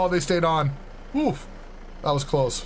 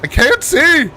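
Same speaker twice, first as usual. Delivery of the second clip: high, loud panicked cry; 12 semitones higher, 13 dB louder, slower than usual. Real, not acted. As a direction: yelp it in alarm. Driving blind, panicking.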